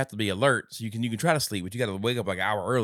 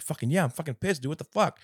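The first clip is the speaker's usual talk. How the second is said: angry; 8 semitones higher than usual. Retold or imitated. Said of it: "John's like".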